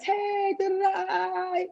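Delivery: high, drawn-out sing-song imitation; sweetly put-on greeting, held long and high; mock nice. Imitating someone being all nice, exaggerated sweetness. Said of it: "being all nice".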